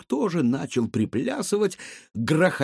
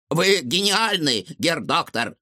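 admiring robot; 5 semitones higher than usual. A narrator's reading.

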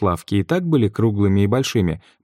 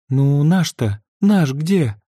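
anxious; 7 semitones higher, much slower than usual. A narrator's reading. Mother fidgets, anxiously searching.

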